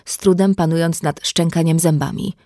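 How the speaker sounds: usual speaking voice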